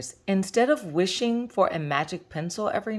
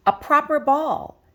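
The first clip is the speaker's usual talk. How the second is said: eager longing; 8 semitones higher, 6 dB louder than usual. A narrator's reading. Child dreams of a real ball.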